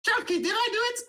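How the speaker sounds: very high, eager little voice asking over and over; dazed halfling. Acted. Halfling guard asking Shalky after toppling, high and eager. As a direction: ask it high and hopeful, dizzy from the fall. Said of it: "he goes".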